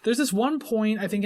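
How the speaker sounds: usual speaking voice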